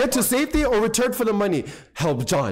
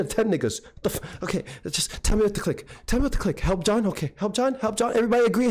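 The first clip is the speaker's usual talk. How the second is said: ordinary talk, nothing marked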